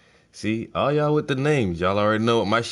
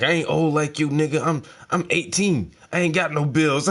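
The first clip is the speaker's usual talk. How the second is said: put-on cocky teen voice; 6 semitones higher, 4 dB louder than usual. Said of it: "He's like, he's like".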